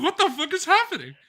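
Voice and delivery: high, loud retold freak-out; storyteller's panicked yelp. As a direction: act out your alarm, voice shooting up. Retelling his panic at gushing blood.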